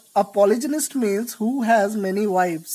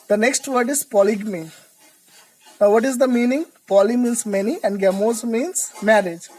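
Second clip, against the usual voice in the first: ordinary talk, nothing marked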